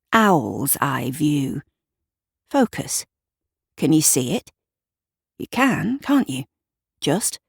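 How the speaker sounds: ordinary delivery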